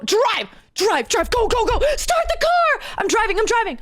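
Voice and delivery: high, mock-panicked getaway cries; panicked urging. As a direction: urge the driver on in hammed-up panic. Acted panicked getaway in the car.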